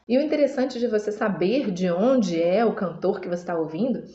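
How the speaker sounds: normal talking voice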